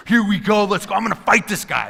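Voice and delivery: high, loud, cocky hype; fired up to fight. Retold or imitated. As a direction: pump yourself up like a teenager before a scrap. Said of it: "I'm thirteen again".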